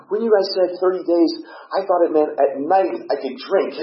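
put-on high, loud girl's voice explaining herself; imitated girl. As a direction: mimic a naive girl pleading her case. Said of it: "She goes".

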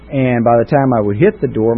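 usual speaking voice

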